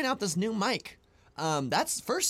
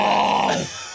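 loud, open hippo bellow; imitated hippo noise; 3 semitones higher, 9 dB louder than usual. Shown as a demonstration. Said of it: "I always, always went".

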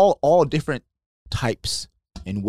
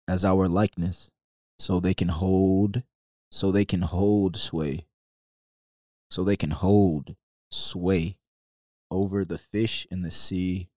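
scripture reading; 5 semitones lower, 4 dB quieter than usual. Acted. Reads Genesis slowly, pausing.

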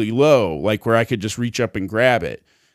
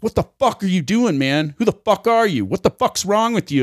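mock freak-out; 6 semitones higher than usual. Dog owner confronting the man petting him, mock freak-out. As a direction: imitate an outraged stranger, pitch jumping up. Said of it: "he freaks the fuck out".

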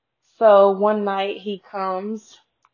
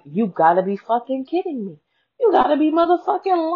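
climbing high and louder in shocked outrage; outraged disbelief; 9 semitones higher, 5 dB louder than usual. Retold or imitated. Reacting to mom's boyfriend saying he'd date her, rising high and loud outrage. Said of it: "And I'm just like".

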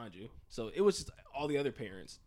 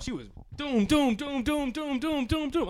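punch sounds; 11 semitones higher, 7 dB louder, slower than usual. Retold or imitated. Imitating mom's punches.

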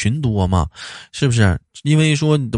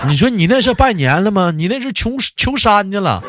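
exasperated rant; 8 semitones higher than usual. Real, not acted. Ranting about mass greetings.

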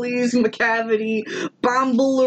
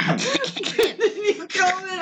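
mock grand order; 8 semitones higher, much slower than usual. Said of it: "You weren't clearing your throat".

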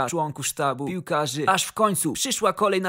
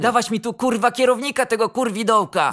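loud, higher-pitched angry order; booming demand; 5 semitones higher, 6 dB louder than usual. A narrator's reading. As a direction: thunder for the manager, furious boss. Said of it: "zagrzmiał donośnie". (donośne, gniewne żądanie)

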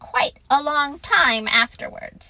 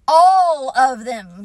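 sulky; 7 dB louder, slower than usual. A narrator's reading. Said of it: "said Owl sulkily".

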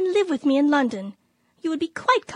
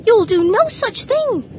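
louder and higher, a sharp scandalised rebuke; shocked scolding; 6 semitones higher, 6 dB louder, slower than usual. Acted. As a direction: stop her niece at once, outraged. Aunt forbids taking boots off.

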